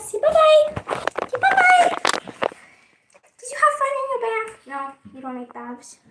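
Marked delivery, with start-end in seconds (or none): 0.0-2.0 very high, louder "bye-bye"; sing-song goodbye for the dog. Real, not acted. Prompting dog to wave bye. 3.4-4.7 high, fast pet talk; chirpy question to the dog. Real, not acted. Asking dog after bath.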